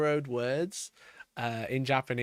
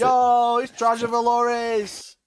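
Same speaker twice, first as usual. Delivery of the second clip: loud hyped-up shout, drawn out; excited shout; 7 semitones higher, 7 dB louder, much slower than usual. Real, not acted. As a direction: shout it like a hype man. Hyping the painted army.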